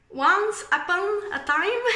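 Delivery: slow, careful English accent on "Once Upon a Time", each word drawn out; a francophone proudly trying an English accent, slow and deliberate. Shown as a demonstration. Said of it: "je vais vous sortir un peu mon petit accent anglais".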